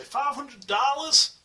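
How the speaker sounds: high, loud, drawn-out American disbelief; mock American accent. Imagined critics balking at the statue's price, mock American accent. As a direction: put on an outraged American accent. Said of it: "I did the American accents".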